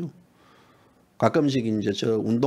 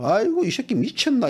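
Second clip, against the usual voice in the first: mock disbelief, pitched up high; the desk editor scoffing in disbelief; exasperated boss; 9 semitones higher than usual. Reporter retells his editor's reaction, mock disbelief pitched high. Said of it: "이러면서 막 그러더라고요".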